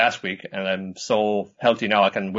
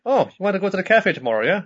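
chirpy Nodoka; 9 semitones higher, faster than usual. Retold or imitated. Voicing Nodoka eager.

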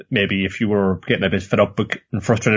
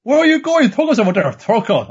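high, louder mock outrage; hammed-up indignant shout; 12 semitones higher, 4 dB louder than usual. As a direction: yelp like you can't believe it. Voicing Thorfinn chasing Thorkill.

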